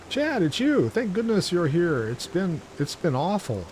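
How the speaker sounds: high, relieved greeting; relieved character voice. Acted. Voicing relieved game character.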